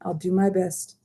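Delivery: patient. Acted. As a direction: calmly keep your patience. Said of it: "Sophie said patiently".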